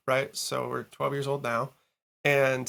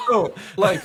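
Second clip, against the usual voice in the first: mildly stunned retold reaction; retold amazement; 5 semitones higher, 5 dB louder than usual. Musician reacting to neighbour's famous band, retold amazement. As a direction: replay being taken aback, a bit higher and louder. Stunned reply to Boston in story.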